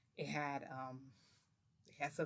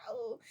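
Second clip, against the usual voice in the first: high "oh", imitating him; high-pitched retold "oh"; imitated "oh" noise; 6 semitones higher than usual. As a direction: mimic his odd "oh" sound. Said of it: "He made, like, a "Oh" noise".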